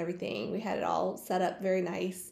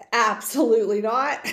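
mad refusal; 9 dB louder than usual. Retold or imitated. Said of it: "my mom was just really mad".

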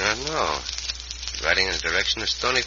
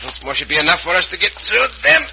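higher, louder with effort; straining effort; 10 semitones higher, 5 dB louder than usual. Acted. Cisco bending the jail bars, straining loud and high.

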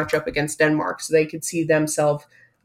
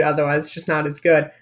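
ordinary talk

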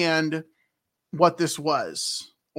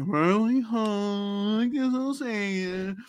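drawn-out, reluctant singsong; grudging compliance; 4 semitones higher, slower than usual. Acted. Pia gives in to the villagers, slow and reluctant. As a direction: drag the greeting out grudgingly. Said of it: "And Pia's like".

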